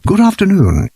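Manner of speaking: high, polite small-bear voice; polite little bear. A narrator's reading. Small bear greeting the Browns politely, high voice. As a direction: raise the pitch for a tiny courteous bear. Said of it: "it said".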